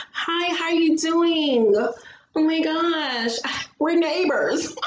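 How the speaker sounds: slow, high, sugary hello; flirty greeting. Pretend. Pretending to meet the neighbor on a jog, slow high flirty.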